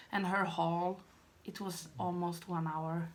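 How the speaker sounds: usual speaking voice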